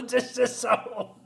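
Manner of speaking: words still shaking with laughter; laughing through words. Real, not acted.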